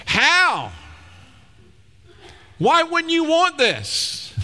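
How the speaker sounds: very high, stretched-out exclamation; incredulous appeal. Real, not acted. Preacher asking congregation why refuse grace, incredulous appeal. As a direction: plead in disbelief at full pulpit pitch.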